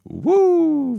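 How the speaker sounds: a very high, loud whoop of hype; excited woo. Real, not acted. Host hyping himself after a funny recap, high whoop.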